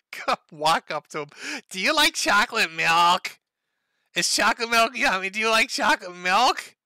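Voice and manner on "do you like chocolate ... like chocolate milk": mock creepy voice. Imitating the poster approaching the old man. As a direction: coo it like to a toddler.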